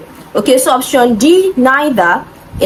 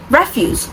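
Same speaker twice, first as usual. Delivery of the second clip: "refuse" with the first syllable stressed; 3 semitones higher, 4 dB quieter than usual. Shown as a demonstration. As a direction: say "refuse" as REF-use, the noun. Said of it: "it is stressed on the first syllable".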